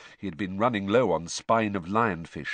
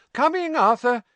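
much higher, louder call to another room; 7 dB louder than usual. A narrator's reading. Wife calling to her husband in the kitchen, loud. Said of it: "called Missus Weasley".

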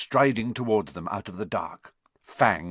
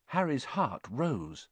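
plain reading voice

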